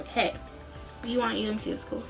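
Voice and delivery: ordinary delivery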